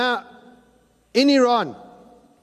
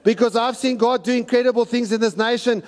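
ordinary talk, nothing marked

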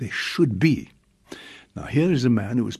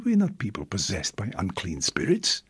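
ordinary delivery

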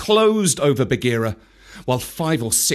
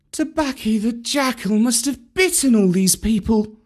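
put-on boy to himself; 8 semitones higher than usual. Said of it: "he said to himself".

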